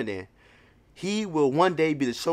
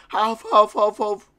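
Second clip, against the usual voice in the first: panting; 9 semitones higher, 7 dB louder, slower than usual. Acted. Voicing heavy panting.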